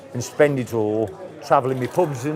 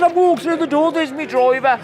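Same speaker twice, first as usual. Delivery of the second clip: higher-pitched retold protest; indignant; 11 semitones higher than usual. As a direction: insist you came in the door. Retold pub argument.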